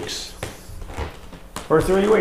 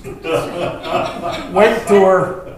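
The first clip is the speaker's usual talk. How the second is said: normal talking voice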